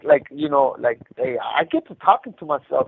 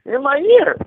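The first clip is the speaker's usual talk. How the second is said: mock triumphant; 10 semitones higher, 4 dB louder than usual. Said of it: "I go".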